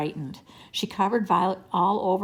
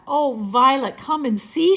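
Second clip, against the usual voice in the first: high, loud summons; calling out; 6 semitones higher, 7 dB louder than usual. A narrator's reading. Jessie calls Violet to see the dog, calling out. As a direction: call her over, voice raised. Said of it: "she called".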